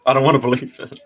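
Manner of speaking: real laugh breaking into the line. Real, not acted. Vlogger on turning twenty-six, laughing in disbelief. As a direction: say it through an amused laugh.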